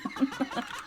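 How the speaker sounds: amused breathy laugh; little giggle at the joke; soft laugh. Real, not acted. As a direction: laugh at yourself. Laughs at own accent.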